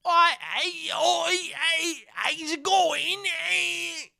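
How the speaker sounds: very high, drawn-out British caricature; mock British accent. Mocking what impressed Americans hear, absurd high British accent. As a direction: squawk cartoon British greetings, stretched out. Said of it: "'cause they hear".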